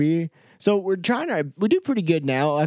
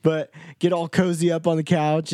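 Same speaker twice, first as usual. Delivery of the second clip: usual speaking voice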